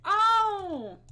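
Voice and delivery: high, loud delighted "Oh!"; surprised shout. Real, not acted. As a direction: burst out in happy surprise. Sees fan drawing of herself.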